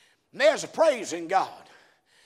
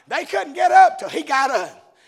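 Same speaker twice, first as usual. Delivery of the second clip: raised, louder proclamation; emphatic preaching; 6 semitones higher, 9 dB louder than usual. Real, not acted. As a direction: punch it out to the congregation. Repeats the line emphatically.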